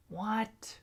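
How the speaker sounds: high, incredulous what; disbelief. Real, not acted. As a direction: react to absurd news. Shocked by old price.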